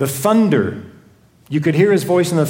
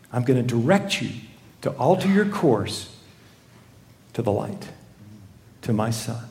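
soft, low, solemn voice; gentle divine directive; 6 semitones lower, 7 dB quieter than usual. Acted. God directing us to his Son, soft and solemn. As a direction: let the words settle quietly between pauses. God's words voiced to listeners.

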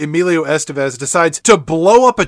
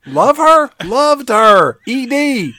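wounded soldier gasping; 7 semitones higher than usual. Pretend. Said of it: "dying soldier bit".

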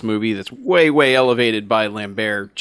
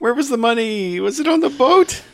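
mock questioning; 13 semitones higher than usual. Retold question amid laughter.

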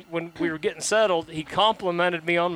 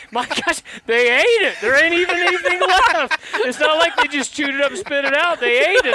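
louder, higher exclaiming disbelief; incredulous neighbor; 12 semitones higher, 7 dB louder than usual. Retold or imitated. Neighbor staring at the eaten fence, loud amazed disbelief. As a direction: blurt it out, amazed the wood is gone.

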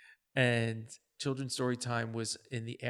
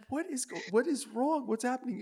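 worried; 10 semitones higher, 3 dB quieter than usual. Retold or imitated. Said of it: "And I'm like".

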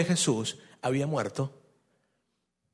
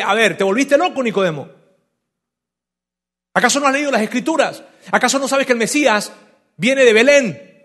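burla de fariseo; 4 semitones higher, 6 dB louder than usual. Retold or imitated. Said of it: "le está hablando directamente a Nicodemo".

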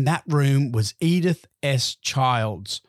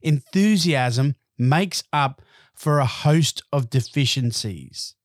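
"Enthusiasm makes up for a host of deficiencies" said slowly, each word weighed; slow it down so it sinks in; slow emphatic repeat. Shown as a demonstration. Speaker repeating the quote so listeners hear it, slow and emphatic. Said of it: "I want you to hear it".

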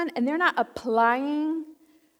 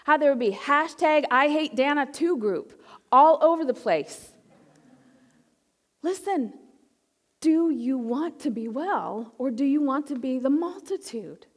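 ordinary delivery

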